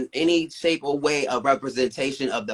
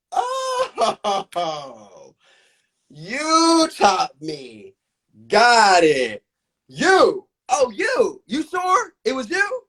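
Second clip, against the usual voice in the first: sarcastic disbelief; 8 semitones higher, 5 dB louder, much slower than usual. Retold or imitated. Sarcastic retort to the judge.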